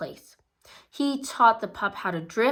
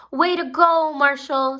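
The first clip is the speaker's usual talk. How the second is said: loud, higher cheer; 6 semitones higher, 7 dB louder than usual. A narrator's reading. Someone cheering Marshall's win, loud and high. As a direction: cheer him across the line.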